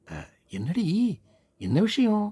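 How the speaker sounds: eager busybody shouting a question; curious neighbour. Acted. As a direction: neighbour calling from the back rooms.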